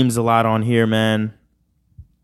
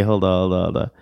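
ordinary talk

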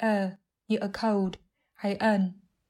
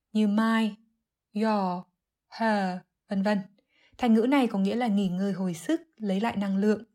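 usual speaking voice

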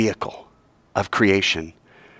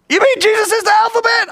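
high, loud disbelieving voice; imagined doubter voiced in disbelief; mock incredulous question; 4 dB louder than usual. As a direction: play the stunned listener.

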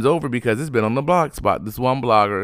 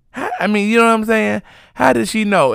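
incredulous; 12 semitones higher, 4 dB louder than usual. Real, not acted. Incredulous questioning.

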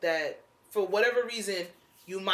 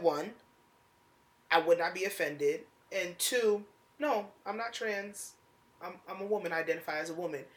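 composed, softer, point-by-point answer; measured reply; 2 semitones lower, 6 dB quieter than usual. Retold or imitated. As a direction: set it out evenly and softly. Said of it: "I said it just like this".

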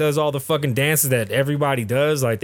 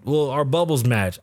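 normal talking voice

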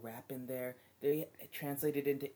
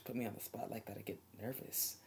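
usual speaking voice

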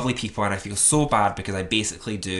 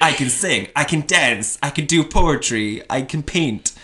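raised, chirpy list of talents; mock boasting; 5 semitones higher, 5 dB louder than usual. Likening self to Barbie, mock boasting in a raised voice. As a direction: rattle off each skill like a showy doll.

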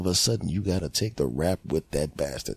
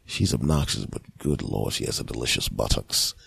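low, soft lecherous murmur; lower, creepier voice for a dirty thought; pervert inner voice; 12 semitones lower, 4 dB quieter than usual. Retold or imitated. His pervert inner voice ogling a woman, low and soft. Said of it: "The first thing the little voice said".

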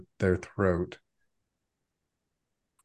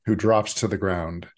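normal talking voice

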